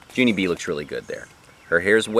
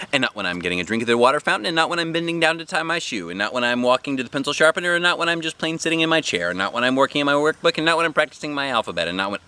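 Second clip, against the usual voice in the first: put-on fast, higher little girl listing on and on; breathless kid who won't stop; rapid, higher childish listing; rattling off a list; 7 semitones higher, faster than usual. A narrator's reading.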